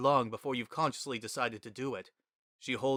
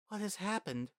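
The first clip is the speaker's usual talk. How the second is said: higher, softer worried question; Toriel, concerned; 9 semitones higher, 6 dB quieter than usual. A narrator's reading. Toriel asking sobbing Frisk, soft higher worried voice. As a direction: ask gently in concern.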